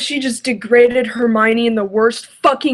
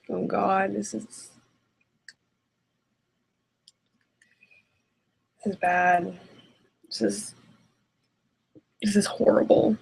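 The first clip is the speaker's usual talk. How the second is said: hushed despair; 2 semitones lower, 8 dB quieter than usual. Real, not acted. Reader despairs at bad text.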